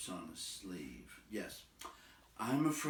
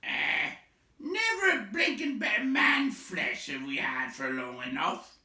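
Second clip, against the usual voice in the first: high, loud troll complaint; a second troll whining over the fire; 10 semitones higher, 7 dB louder than usual. Acted. Second troll whining to the others about no man flesh.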